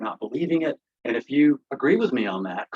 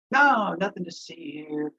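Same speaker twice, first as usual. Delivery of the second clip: mock dismissal; 7 semitones higher, slower than usual. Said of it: "con you into believing".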